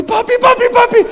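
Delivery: high, loud cries of papi; panicked kid scream. Retold or imitated. Little brother yelling at his dad in high panic. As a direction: scream for him to stop.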